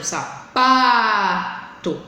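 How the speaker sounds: "sapato" slowly, PA belted out and held long; lingering stressed syllable. Shown as a demonstration. Teacher proving the tonic syllable, PA forcefully drawn out in "sapato". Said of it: "eu demorei mais".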